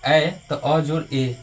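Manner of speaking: very slow, ए stretched long; slow demo. Shown as a demonstration. Teacher to students, slowly joining the vowels. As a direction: slowly build ए from अ. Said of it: "ये जोड़ के देखिए".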